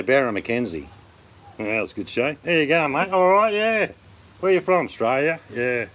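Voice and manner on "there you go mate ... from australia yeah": put-on broad Australian accent pitched much higher and louder; hammed-up broad Australian. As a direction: overplay the ocker Australian twang. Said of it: "We don't really talk like that".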